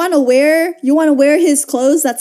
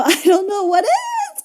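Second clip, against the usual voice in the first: laughing; 7 semitones higher than usual. Real, not acted. Laughing at the changed prince.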